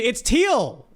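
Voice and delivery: "Teal" with a plain T, high and drawn out; "Teal" with no th sound, excited; hard T. Real, not acted. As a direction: announce "Teal" like the colour, triumphantly. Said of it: "look it up to pronounce it".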